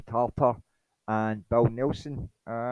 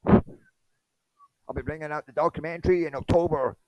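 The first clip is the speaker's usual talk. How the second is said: mock self-promoter, pitched up; lightly put-on higher voice; Jeremy Corbell; 7 semitones higher than usual. Jeremy plugging his documentary, mocking higher voice.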